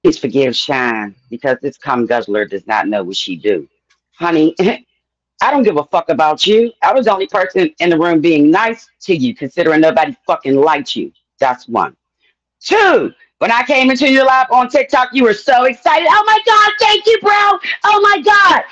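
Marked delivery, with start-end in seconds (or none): 0.0-12.0 pitched-down sarcastic preacher voice; deep mocking voice. Speaker held far lower voice. 16.1-18.6 mocking someone fangirling; mock excited fan.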